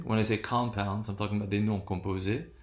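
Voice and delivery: ordinary delivery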